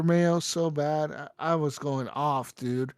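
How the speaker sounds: ordinary talk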